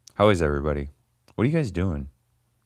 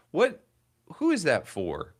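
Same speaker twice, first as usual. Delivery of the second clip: surprised; 11 semitones higher than usual. Real, not acted. Reacting to wait timer.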